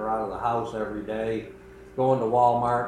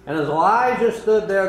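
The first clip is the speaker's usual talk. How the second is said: normal talking voice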